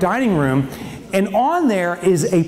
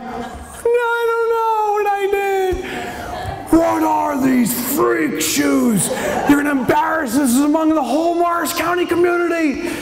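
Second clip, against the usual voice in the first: much higher, shouting through laughter; panicked high protest; 10 semitones higher than usual. Retold or imitated. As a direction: squeal a desperate defense. Defending shoes to angry wife.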